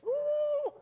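high, loud preacher's whoop; joyful whoop. Real, not acted. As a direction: let a burst of joy fly. Preacher erupts mid-sermon.

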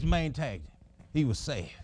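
ordinary talk, nothing marked

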